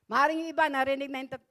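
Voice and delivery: ordinary talk, nothing marked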